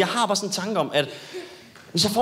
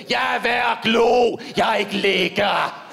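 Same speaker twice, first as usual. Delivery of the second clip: mock yell; 3 semitones higher, much slower than usual. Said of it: "og råbe".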